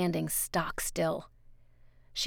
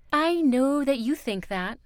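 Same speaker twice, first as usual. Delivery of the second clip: higher, louder character voice; raised, insistent woman's voice; Miss Foster, insistent; 7 semitones higher, 7 dB louder than usual. Acted. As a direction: talk down to a child, firmly and loudly.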